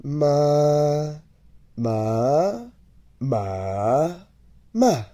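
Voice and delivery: "Ma" high level, rising, dipping, then sharply falling; four tones in turn. Shown as a demonstration. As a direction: run "Ma" through the four Mandarin tones. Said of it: "Let's take each one at a time".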